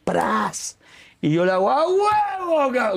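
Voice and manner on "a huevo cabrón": high, slow, drawn-out victory yell; triumphant cheer. Retold or imitated. Cheering his friend after the winning shot, hammed up. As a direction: cheer him on like he won.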